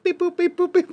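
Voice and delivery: high, chirpy beep-boop sounds; phone beep noises. Retold or imitated. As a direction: imitate pressing phone keys. Imitates dialing a phone.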